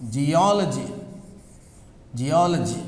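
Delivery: geology stressed on "ol", low-pitched; stress on second syllable. Shown as a demonstration. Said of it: "you have that stress here".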